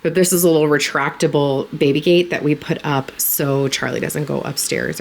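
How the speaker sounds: ordinary talk, nothing marked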